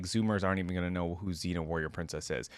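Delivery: usual speaking voice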